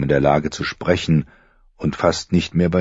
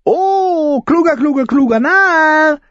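much higher, loud, crowing fool; shrill crowing fool; 5 dB louder, slower than usual. A narrator's reading. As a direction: crow it out like a mad jester. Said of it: "krähte Flickenfratz schrill".

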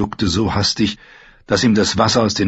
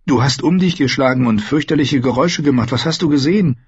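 a touch of a character voice, higher-pitched; lightly voiced Meera, concerned questioning; 6 semitones higher, faster than usual. A narrator's reading. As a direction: lightly voice a companion asking what happened. (leicht verstellte, höhere Stimme, fragend)

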